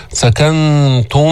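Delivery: slow سكنتم with nasal hums held on nun and final mim; with ghunna. Shown as a demonstration. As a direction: hum through the nasal sounds in سكنتم. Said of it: "مع الغنة،".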